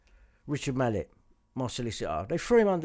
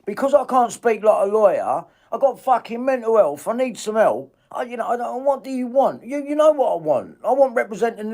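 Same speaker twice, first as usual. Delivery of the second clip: loud, outraged shouting at the lawyer on the call; raging at a lawyer who won't help; furious rant; 4 semitones higher, 9 dB louder than usual. Real, not acted. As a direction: vent the anger at full volume.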